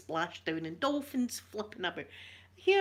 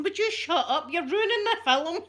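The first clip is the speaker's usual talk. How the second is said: laughing mock yell, higher and louder; husband snapping; 8 semitones higher, 5 dB louder than usual. Ex-husband hushing her during the film, mock annoyed. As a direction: play the fed-up husband through laughter. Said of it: "my ex-husband going".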